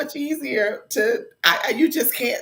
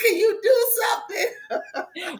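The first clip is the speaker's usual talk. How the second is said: mock-plead through laughter; laughing exasperated plea; 4 semitones higher than usual. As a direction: mom begging Gigi through laughs. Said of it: "I'm like".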